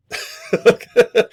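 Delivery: a sudden high laugh; host laughs at his cereal joke, high laugh. Real, not acted.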